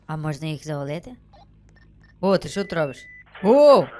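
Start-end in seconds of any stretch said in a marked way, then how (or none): 3.4-3.9 loud, high burst of surprise; startled exclamation. Real, not acted. Player startled by what happens in the game, loud surprise. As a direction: blurt it out, startled.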